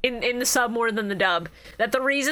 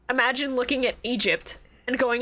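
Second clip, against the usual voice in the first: ordinary delivery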